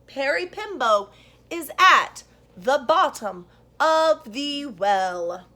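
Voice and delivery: put-on very slow, loud deliberate child; boy carefully saying the long name; very slowly. A narrator's reading. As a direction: spell out every syllable slowly. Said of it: "very slowly said".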